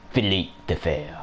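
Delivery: Philippe the Fair with a light French accent on Philippe; lightly Frenchify Philippe. Shown as a demonstration. Gamer showing viewers the French sounds better. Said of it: "Way better in French".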